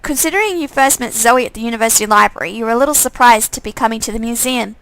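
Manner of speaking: ordinary talk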